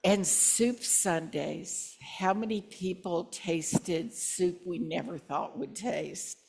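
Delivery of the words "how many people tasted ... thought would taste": ordinary talk, nothing marked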